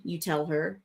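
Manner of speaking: usual speaking voice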